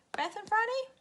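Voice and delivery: very high, teasing question; teacher quizzing pupils about rhymes; playful quiz. Acted. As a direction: ask it with mock suspense.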